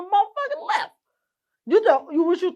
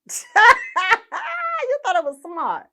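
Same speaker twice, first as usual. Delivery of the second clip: slow, stretched disbelief with a laugh; incredulous; 7 semitones higher, much slower than usual. Real, not acted. Mocking the scammer's plan, slow and incredulous. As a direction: drag it out, mocking his nerve.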